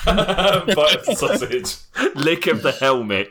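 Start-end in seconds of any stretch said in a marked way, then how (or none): 0.1-1.8 laughing through the words; giggling as he speaks. Real, not acted. Laughing at the joke.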